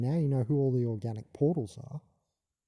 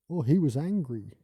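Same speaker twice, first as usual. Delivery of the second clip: raised mocking voice of a dismissive person; mock quote; 4 semitones higher, 6 dB louder than usual. Mocking the people who judge tone. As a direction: sneer it like someone brushing off the point.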